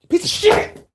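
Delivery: high, loud yell at the lighter; angry outburst. Real, not acted. Host cursing his lighter that won't work, a loud angry yell. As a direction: snap at the broken lighter in frustration.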